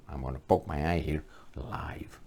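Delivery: whispered, half-laughing; whispered aside. Real, not acted. An aside to viewers about poking his eye, whispered. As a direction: lean in and whisper it.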